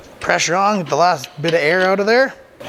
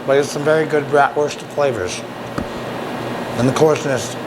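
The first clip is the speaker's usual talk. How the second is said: talking with mouth full; speaking through a mouthful of sausage; 4 semitones lower than usual. Real, not acted. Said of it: "talking with my mouth full".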